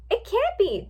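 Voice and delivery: high-pitched shocked denial; gasped disbelief. A narrator's reading. Twilight hearing of Starling Glimmer's rampage, gasped disbelief. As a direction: refuse to believe it, pitched high. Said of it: "Twilight gasped".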